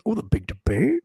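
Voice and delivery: high, swooping mock-puzzled question. Host mocking his own slip, exaggerated puzzled question.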